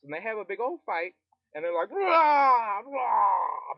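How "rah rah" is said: loud, very high shouted war cry; battle cry. Retold or imitated. Rebels charging the British with a loud war cry. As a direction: roar into battle. Big fight, battle cries.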